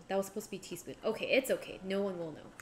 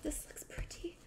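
whisper; 5 semitones higher, 7 dB quieter than usual. Real, not acted. Hushed aside over the food.

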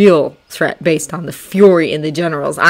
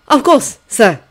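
put-on higher, louder eager young officer; eager Frenchman; 6 semitones higher, 6 dB louder, slower than usual. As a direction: plead keenly as a French officer. Lafayette voiced in story.

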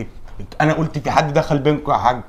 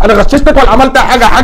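indignant protest; 6 semitones higher, 8 dB louder, faster than usual. Acted. Heated argument with father.

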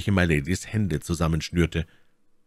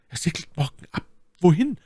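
My Lady, alarmed; 11 semitones higher than usual. A narrator's reading. Lady hearing of the sailing, high alarmed questions. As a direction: start hushed, then shoot out the question.

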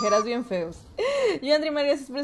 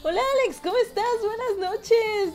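playful high-voiced hello to a viewer; squeaky high greeting; 8 semitones higher than usual. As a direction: pitch it way up like a cartoon greeting. Reading a chat greeting in a put-on voice.

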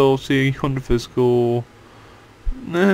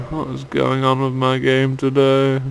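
ordinary delivery

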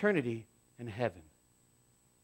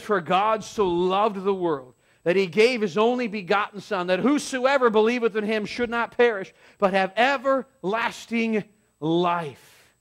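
slow, louder solemn recitation; scripture reading; 4 semitones higher, 6 dB louder, slower than usual. Acted. Pastor reciting John 3:16 to the congregation, solemn and slow. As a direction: read the verse slowly with weight.

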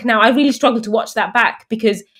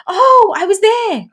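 put-on high, slow, amazed little girl; a daughter remembering she saw mum win, high and slow; 10 semitones higher, slower than usual. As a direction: draw it out like a child remembering. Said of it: "she goes".

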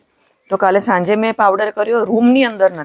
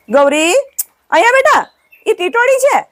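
calling the cow; 12 semitones higher than usual. Real, not acted. Woman calls her cow Gauri over, high coaxing call. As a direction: coax her over from afar.

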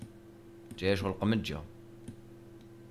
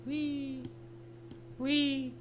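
squeaky yes; 8 dB quieter, much slower than usual. Pretend.

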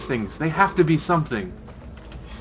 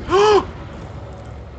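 shocked gasp. Real, not acted.

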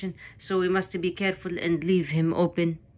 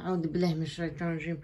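rapid mutter; 8 dB quieter, much faster than usual. Real, not acted. Muttering protection prayer fast.

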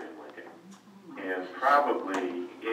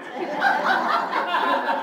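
an airy burst of laughter, pitched up; breathy laugh; 7 dB louder than usual. Real, not acted. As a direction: laugh out loud at the memory. Laughs at the woman's answer.

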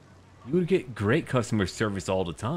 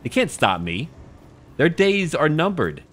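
gloating; 9 semitones higher, 9 dB louder than usual. Pretend. Gloating villain threat.

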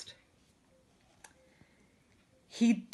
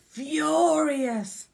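"furious" drawn out in a swell; stress on furious; 8 semitones higher, 4 dB louder than usual. A narrator's reading. Storyteller telling children of captain's fury, "furious" drawn out. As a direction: stretch and swell the word "furious".

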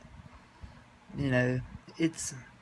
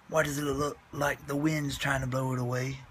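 ordinary talk

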